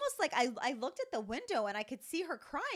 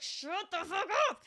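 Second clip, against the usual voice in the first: slow, higher, louder mock yell; 8 semitones higher, 5 dB louder, slower than usual. Mom voicing what parents want to yell at a tantrum, in a slow loud mock yell. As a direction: blow up at the kids, half joking. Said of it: "you wanna be like".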